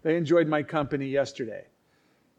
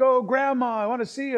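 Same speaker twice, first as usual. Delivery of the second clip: high, louder mock greeting; gushing relatives, imitated; 8 semitones higher, 3 dB louder than usual. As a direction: gush like family arriving to see Grandma. Retold family greeting their grandmother.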